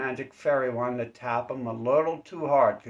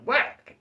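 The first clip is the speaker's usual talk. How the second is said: high, loud cartoon hit noise; whack sound effect; 13 semitones higher, 6 dB louder than usual. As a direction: smack it out like a comic punch. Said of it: "they're like".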